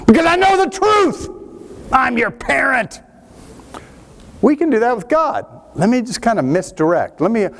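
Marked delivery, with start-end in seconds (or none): 0.1-3.0 a knowing parent voice, pitched up high; lightly hammed-up all-knowing parent, smug; mock parent.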